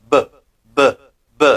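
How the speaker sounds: "B" avec vibration des cordes vocales; voiced b demo. Shown as a demonstration. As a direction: buzz the "B" so the throat vibrates. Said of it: "il y a une vibration". ("B" voisé, on sent la vibration)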